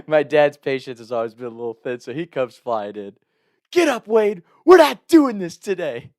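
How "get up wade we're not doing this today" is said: higher, louder angry dad order; dad shouting his son out of bed; dad yelling. Retold or imitated. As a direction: snap it like an impatient father.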